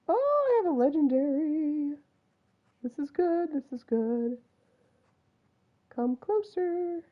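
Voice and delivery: high, soft, sing-out coaxing; hushed delight. Real, not acted. Hunter luring a legendary closer, hushed delight. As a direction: coo excitedly without scaring it off.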